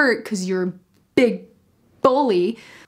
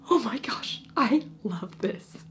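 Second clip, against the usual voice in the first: breathy gushing whisper, slowing at the end; excited whisper; 4 dB quieter than usual. Retold or imitated. Reader whispering her own delight over the book. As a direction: whisper it like a secret thrill. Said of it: "And I'm like".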